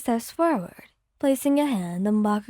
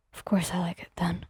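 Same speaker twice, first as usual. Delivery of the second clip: lower, whispered reply; 7 semitones lower, 5 dB quieter than usual. Acted. Bakugo answering Wyon's tease, whispered. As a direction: whisper it back fondly.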